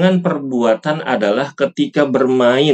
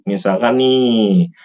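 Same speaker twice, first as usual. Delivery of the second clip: usual speaking voice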